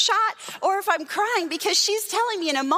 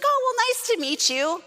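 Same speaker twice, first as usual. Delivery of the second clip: high-pitched imitation of a polite shopper; polite pleasantry; 8 semitones higher than usual. Shopper meeting a frazzled pastor, high mock-polite voice. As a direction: put on a high, courteous goodbye.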